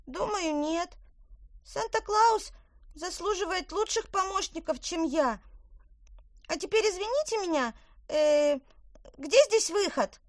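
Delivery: high, bright little elf; squeaky elf speaking. Acted.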